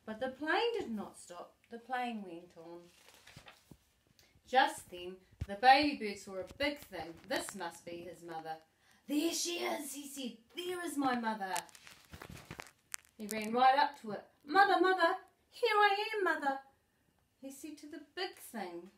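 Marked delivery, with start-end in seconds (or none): 0.1-14.3 animated read-aloud, bigger at each question; lively storybook reading. Acted. Grandma acting out the story for her granddaughter, lively storybook voice. 14.4-16.6 high, loud, eager cries of mother; excited calling. Acted. The baby bird running to the big thing, calling high and loud.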